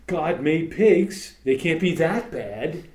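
slow, drawn-out mocking justification; mock excuse. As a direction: play the lazy excuse-maker, slowly. Mocking a lax believer's excuse.

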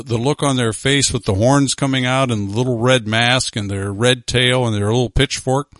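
ordinary talk, nothing marked

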